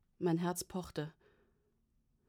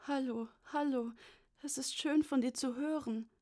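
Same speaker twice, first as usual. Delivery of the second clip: put-on high-pitched, frail old father on the phone; wistful, slightly breathless; 7 semitones higher than usual. A narrator's reading. Elderly father greeting his daughter on the phone, wistful and slightly breathless. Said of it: "wehmütig und ein wenig atemlos geklungen".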